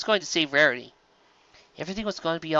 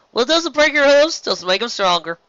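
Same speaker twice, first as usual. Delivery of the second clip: Applejack voice; 10 semitones higher, 6 dB louder than usual. Acted.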